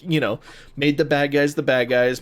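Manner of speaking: ordinary talk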